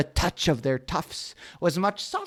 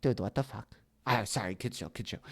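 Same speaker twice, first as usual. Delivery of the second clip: ordinary delivery, nothing marked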